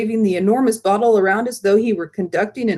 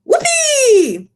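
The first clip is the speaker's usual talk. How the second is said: very high, loud whoop; whooping cry; 4 dB louder than usual. Acted. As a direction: whoop with joy. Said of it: "he cried".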